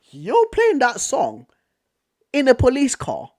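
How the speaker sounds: slow stunned high question; incredulous. Retold or imitated. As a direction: stretch it out, amazed. Retold disbelief at cop.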